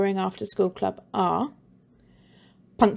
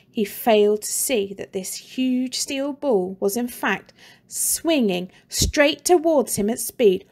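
ordinary delivery

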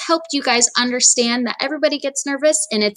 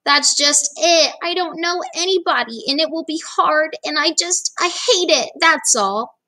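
put-on a touch of a whimpering kid; upset child; 6 semitones higher than usual. Sarah explaining her fears to dad, mildly upset. Girl upset about new school.

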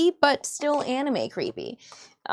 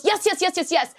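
higher, rapid-fire eager yeses; excited; 5 semitones higher than usual. Retold or imitated. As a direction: imitate an eagerly agreeing mother. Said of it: "she excitedly goes".

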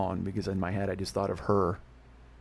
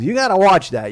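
loud, high imitation of mom warning; mock maternal warning; warning mother; 8 semitones higher, 11 dB louder than usual. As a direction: warn your son loudly in mom's raised voice. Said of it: "She goes".

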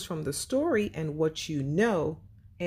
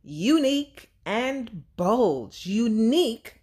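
higher and louder, slow on the words; big grand word reveal; announcer hype; 6 semitones higher, 5 dB louder, slower than usual. Acted. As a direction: present the words like a game-show reveal.

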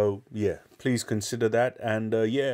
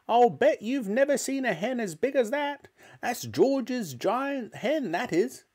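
put-on boastful old grandma; 13 semitones higher than usual. Grandma boasting to George's mother about the hen, high and gloating. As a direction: show off the giant hen like a witchy granny. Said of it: "Grandma shouted".

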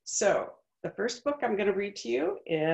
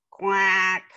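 put-on lively read-aloud voice for children; story narration; 4 dB louder than usual. A narrator's reading. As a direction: tell it like a picture book to a class. Reads picture book aloud.

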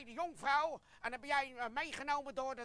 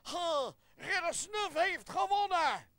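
high, loud victory cry; hammed-up heroic proclamation; triumphant boast; 8 semitones higher, 10 dB louder, slower than usual. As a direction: crow about winning the duel. Knight declares himself the winner.

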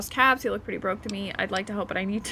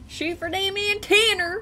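mock higher echo; 9 semitones higher than usual. Shown as a demonstration. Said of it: "Shoot for their antenna".

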